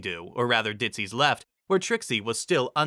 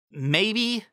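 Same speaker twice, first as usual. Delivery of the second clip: high, doubtful; high unsure voice; 10 semitones higher than usual. A narrator's reading. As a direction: ask it high. Trixie voiced.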